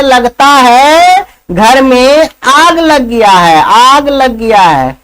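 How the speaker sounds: slow, lazy, unbothered; a lazy man barely moving his lips; slow lazy drawl. Acted. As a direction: stretch it out like you can't be bothered to move. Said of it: "दूसरा क्या कहता है".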